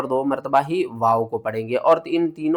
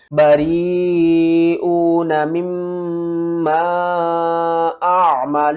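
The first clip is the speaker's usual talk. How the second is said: Quran recitation; 3 semitones higher, much slower than usual. Shown as a demonstration. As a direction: recite with tajweed, stretching the madd. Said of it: "کس طرح؟".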